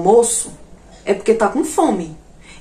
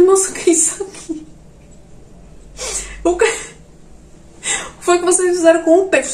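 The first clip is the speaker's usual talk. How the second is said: shocked question; 8 semitones higher than usual. Retold or imitated. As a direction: cry out at the waitress in shock. Shocked at the fish. (pergunta chocada, aguda e alta)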